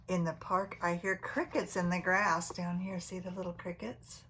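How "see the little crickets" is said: plain reading voice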